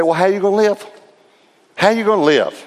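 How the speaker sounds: high-pitched, disbelieving question; incredulous pastor. Retold or imitated. Pastor questioning a minister without fees, high and incredulous. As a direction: push the pitch right up in astonishment. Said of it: "Just like that".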